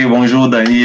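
ordinary talk

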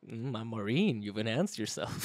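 deadpan a gruff soldier line, low and quiet; mock Marine voice. Joking impression about implants. (imitación burlona de un marine, grave y baja)

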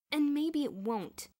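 slow, loud, bitter retort; growled. A narrator's reading. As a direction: growl it back slowly and loudly. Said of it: "Bruno growled".

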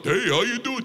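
high, friendly cartoon voice; cartoon moose. Playing a cartoon moose for the crowd, cheerful high greeting. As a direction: chirp it like a goofy cartoon animal. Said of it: "moose are like".

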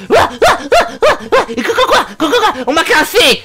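very high, loud yapping dog voice; frantic barking dog. Acted. Dog boss overwhelmed by being petted, frantic high yapping. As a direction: yelp the words out in rapid barks.

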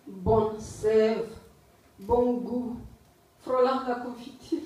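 deliberate recital in short, separated phrases; poetry reading. Acted. Poet reading a poem on exile to listeners, poetry reading. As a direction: declaim the poem, pausing after each phrase. Reads last poem aloud.

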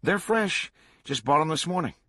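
high-pitched, chipper reassurance; upbeat, pitched well up; cheerful reply. A narrator's reading. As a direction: answer brightly and cheerfully. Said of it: "Swango replied cheerfully".

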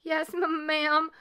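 high, nervous stammer. A narrator's reading. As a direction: stumble timidly over the word. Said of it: "Leola stammered".

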